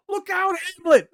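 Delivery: high, loud yelled warning; mock shout. Lincoln yelling a warning at the play, high and loud. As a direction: yell it at the stage like a frantic audience member.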